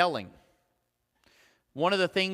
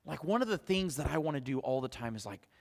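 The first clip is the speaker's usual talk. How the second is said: usual speaking voice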